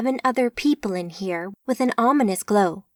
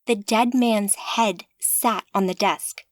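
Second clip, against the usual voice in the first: ordinary delivery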